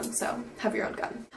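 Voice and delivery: soft voiceless advice; whispered aside. Real, not acted. Vlogger whispering a tip to viewers. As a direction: whisper it under your breath.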